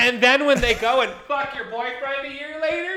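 high, loud, laughing; laughing jab. Real, not acted. Host teasing co-host about the boyfriend, laughing. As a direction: cackle through the punchline.

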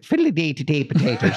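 put-on a playful, over-the-top stage Irish accent; mock stage Irish. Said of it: "the sharper it gets until you're, like, doing the".

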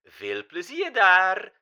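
put-on high, loud, slow German-accented goodbye; old German diva calling goodbye; German-accented farewell. A narrator's reading. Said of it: "riep haar levensgrote projectie".

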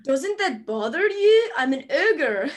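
a hint of a grumbling ogre, slowish; lightly voiced ogre, asking again; Shrek impression. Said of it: "do it again".

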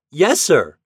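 high, loud, prompt answer; eager child's shouted reply; boy calling back. A narrator's reading. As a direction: pipe back eagerly across the water. Boy calls back to captain.

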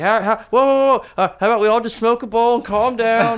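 high, loud placating whoa; calm everyone down, hammed-up; mock peacemaker. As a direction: panicky stoner peacemaker. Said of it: "He's like".

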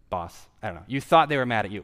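ordinary talk, nothing marked

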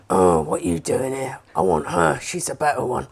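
very low, gruff put-on dog voice; boyfriend's dog voice. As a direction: growl it out as a grumpy dog. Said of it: "He's like".

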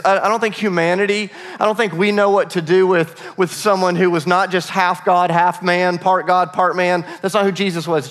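ordinary talk

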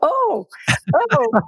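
high, laughing 'oh' of recognition; real laughter breaking through the exclamation; laughing groan. Real, not acted. Laughs at a knowing question.

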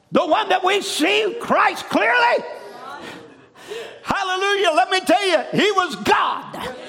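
rising preacher's cry, building; preaching. Acted. Preacher exhorting the congregation, rising fervent cry. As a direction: proclaim it to the congregation.

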